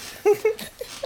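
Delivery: high squealing giggle at the tickling; high ticklish giggle. Acted. Old lady giggles high as the carer tickles her feet.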